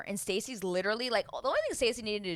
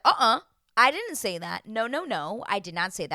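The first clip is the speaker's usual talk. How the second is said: firm denial; 3 semitones higher, 9 dB louder than usual. Retold or imitated. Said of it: "he was like".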